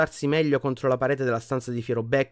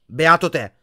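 ordinary delivery

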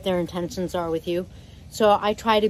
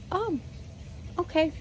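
voicing a bird; 10 semitones higher, 7 dB quieter than usual. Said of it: "they kinda go".

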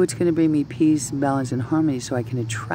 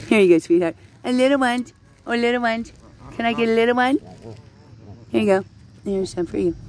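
high, sweet-talking pet voice; coaxing little ducks; 10 semitones higher than usual. Real, not acted. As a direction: sweet-talk a small animal, pitched well up.

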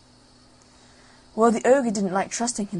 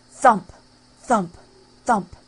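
loud thumps; 7 dB louder, slower than usual. A narrator's reading. Footsteps approach.

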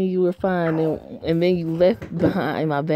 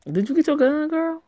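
high, teasing sing-song jab; sassy mock taunt; 11 semitones higher than usual. Real, not acted. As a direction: needle her about the gun, way up high. Reader's sassy aside to character.

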